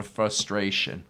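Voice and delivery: ordinary delivery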